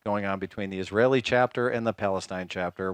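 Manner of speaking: usual speaking voice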